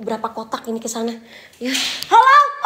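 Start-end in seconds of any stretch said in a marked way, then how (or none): 2.1-2.7 sudden high shouted hello; loud call-out. Real, not acted. Ghost hunter calling to whoever is there, shouted. Calls out into haunted place.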